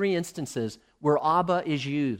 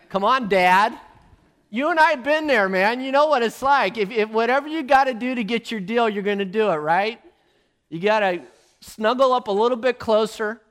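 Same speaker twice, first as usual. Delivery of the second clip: loud, higher, chummy coaxing; buddy-buddy plea to Dad; 7 semitones higher, 8 dB louder than usual. Retold or imitated. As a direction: sweet-talk your father into a deal. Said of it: "He's saying".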